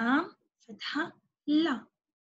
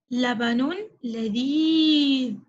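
slow modelled word; 3 dB louder, much slower than usual. Shown as a demonstration. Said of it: "ما هذا الشكل".

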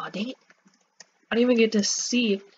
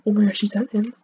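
ordinary delivery

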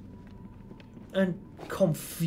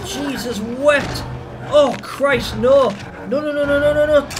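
high, loud cries building in panic; 8 semitones higher, 9 dB louder than usual. Real, not acted. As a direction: cry out in mounting alarm. Fleeing the hunter in game.

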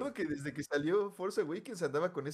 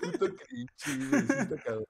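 laughing while talking; 9 semitones higher, 4 dB louder than usual. Real, not acted. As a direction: laugh out the words. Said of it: "laughing at the memory".

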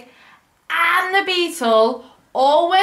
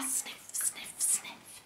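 whispered sniffs; 24 dB quieter, much slower than usual. Acted. Reading to a child, dog sniffs along, whispered.

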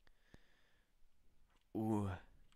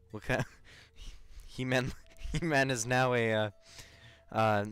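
normal talking voice

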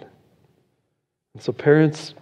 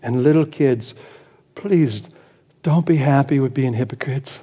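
ordinary talk

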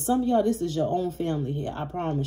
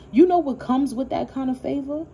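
higher and louder, rising challenge; challenging; 7 semitones higher, 4 dB louder than usual. Real, not acted. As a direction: put the question to them hard. Challenging the haters.

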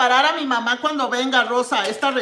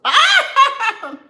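a sudden high burst of laughter; real delighted laugh; loud laugh; 14 semitones higher, 8 dB louder than usual. Real, not acted. Tasting food, bursts out laughing.

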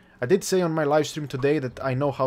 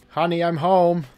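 1 sitcom husband; 3 semitones higher, 6 dB louder than usual. Acted. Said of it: "voicing husband returning".

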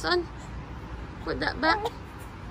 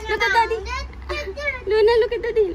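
higher, louder "look at daddy"; 7 semitones higher, 4 dB louder, much faster than usual. Real, not acted. Coaxing baby to look.

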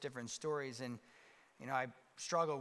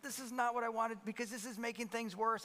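panicked inner thought; 7 semitones higher than usual. Retold or imitated. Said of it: "I remember thinking, I'm like".

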